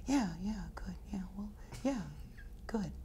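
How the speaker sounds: breathless whisper, very soft; imitating Joel's vague whispered yeah. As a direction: whisper it vaguely, brushing off the question. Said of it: "and you ask Joel and he".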